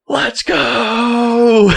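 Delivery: high, drawn-out imitation of a triumphant yell; celebratory scream. Hunter imitating Johnny's yell on finding the deer, screaming. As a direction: whoop it like an elated hunting buddy. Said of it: "He's screaming".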